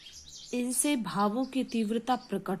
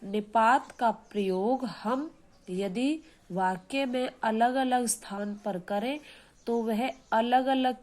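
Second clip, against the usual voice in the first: ordinary talk, nothing marked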